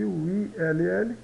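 usual speaking voice

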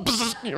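quiet buzzing bug-zapper sound; zap noise. Acted. Preacher mimics moth hitting the light, buzzing zap. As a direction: imitate a bug getting zapped.

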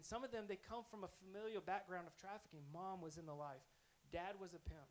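normal talking voice

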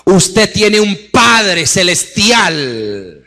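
loud, slow, stretched preaching; fiery preacher booming over music; preaching at full force. Acted. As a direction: thunder God's promise over the church. Preaching God's words at full force.

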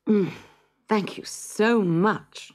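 put-on a hungry child thanking politely, drawn out; grateful little boy. Hansel thanks the old woman.

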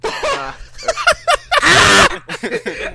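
high, loud burst of laughter; loud howling laugh. Real, not acted. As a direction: cackle hard. Cracking up.

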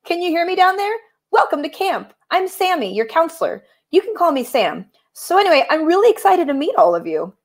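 high, lilting cat counselor; cat camp counselor. Acted. Cat greeting campers from up in the tree, high voice. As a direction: call down in a high, eager counselor voice. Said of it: "the cat up in the tree".

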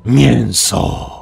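slow, hoarse monster growl-speech; monster's rasp. A narrator's reading. Monster calls hungrily to its prey, raspy. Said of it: "wychrypiał".

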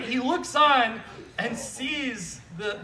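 ordinary delivery

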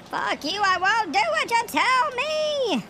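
shouted lyric. Pretend. Yelling song lyric, very high.

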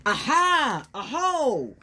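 high, loud discovery cry; excited aha. Acted. Reading to the kids as they spot new tracks, excited. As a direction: announce the find with delight.

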